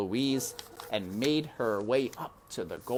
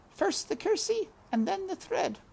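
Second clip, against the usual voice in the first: high, mild mutter; Mig muttering. A narrator's reading. Mig muttering the steps to herself, high. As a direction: recite it under your breath. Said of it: "Mig muttered".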